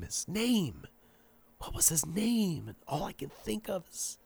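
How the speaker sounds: high, faint confused murmur; dazed murmur. A narrator's reading. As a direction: fade into a lost murmur. Zombie groping for his name.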